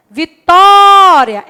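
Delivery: Vitória slowly called out, leaning on tó; Vitória called out with tó stressed; calling out the word. Shown as a demonstration. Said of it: "é você chamar a palavra".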